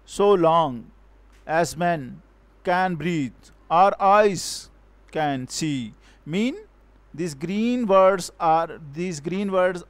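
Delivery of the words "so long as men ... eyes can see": "So long as men" slowly in pairs, weight on "long" and "men"; slow measured beat, "eyes" and "see" stressed; iambic scansion. Shown as a demonstration. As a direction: scan it foot by foot, pausing after "long", "men", "breathe". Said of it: "from iambic pentameter".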